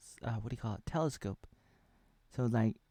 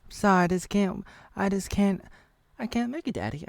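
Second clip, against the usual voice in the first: pleading; 9 semitones higher than usual. Pretend. Daughter pleads, can't hold on.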